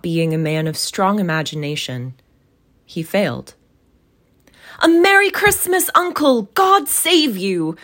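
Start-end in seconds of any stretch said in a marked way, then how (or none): 4.8-7.8 high, loud festive greeting; cheerful cry. Acted. Nephew greets his uncle at Christmas, cheerful cry. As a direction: call out merrily, pitched well above usual. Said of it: "cried a cheerful voice".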